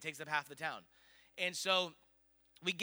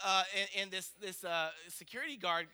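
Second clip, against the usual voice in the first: usual speaking voice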